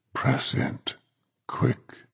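hushed, urgent command; urgent whisper. A narrator's reading. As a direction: whisper it quickly, pressing. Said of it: "strange, deep voice".

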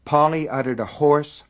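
plain reading voice

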